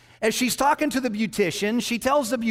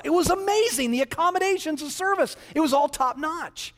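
gushing excitement; 8 semitones higher than usual. Acted. Lady gushes about the villa.

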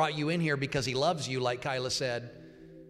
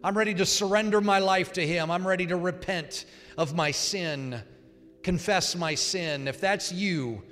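higher and louder altar-call plea; fervent preaching; 4 semitones higher, 3 dB louder than usual. Acted. Pastor urges the room toward prayer, fervently raised voice. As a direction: press them to come forward.

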